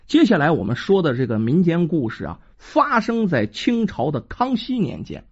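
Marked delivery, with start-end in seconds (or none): none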